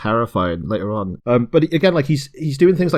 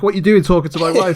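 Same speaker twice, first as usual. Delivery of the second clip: higher, louder mock confrontation; mock angry husband; 7 semitones higher, 4 dB louder than usual. [name] lookalike challenges him over his wife. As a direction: put on a jealous husband's challenge.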